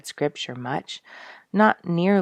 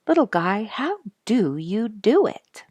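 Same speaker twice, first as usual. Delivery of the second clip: speaking tenderly to a tiny violet, in awe; hushed wonder; 6 semitones higher, 5 dB louder than usual. Retold or imitated. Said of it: "I said".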